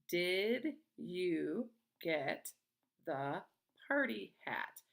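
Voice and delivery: very slow, pause after each word; word-by-word reading. Shown as a demonstration. Teacher reading a sentence to young pupils, slowly word by word. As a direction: read each word separately, falling on "hat".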